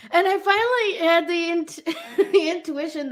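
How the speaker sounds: real laughter breaking into the telling; laughing. Real, not acted. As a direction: giggle out the realization. Laughing while telling the realization.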